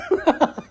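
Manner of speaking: a burst of embarrassed laughter; short, loud chuckle. Real, not acted. Laughs at torn underwear story.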